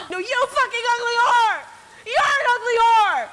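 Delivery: high screaming insult, hammed up; a deliberately botched quote, screamed high; mock furious screech. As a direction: shriek it like an over-the-top villain. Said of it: "Oops, I messed up the quote".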